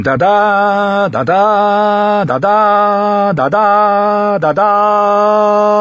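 high, loud brassy trumpet call on each da; trumpet blast. A narrator's reading. Lannister trumpets answering the northern war horn, metallic and defiant. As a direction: sing out the da-das like a challenging fanfare. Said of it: "Wieder gellten die Trompeten".

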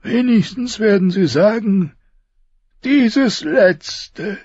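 high, strained voice of a dying king. Acted. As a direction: push each word out through pain. Robert speaks to Ned.

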